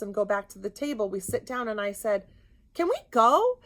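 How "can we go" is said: urgent plea. Retold or imitated. Pleading to leave the restaurant.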